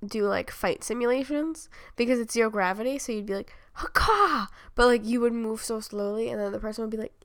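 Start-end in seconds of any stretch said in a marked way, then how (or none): 3.7-4.5 high yelled battle cry. Pretend. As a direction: bark it like a fighter. Fight cry in zero gravity.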